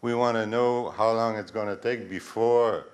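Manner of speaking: a resident asking his question over the PA, close-miked and loud; into the microphone. Real, not acted. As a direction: speak right up into the mic. Asked to speak into microphone.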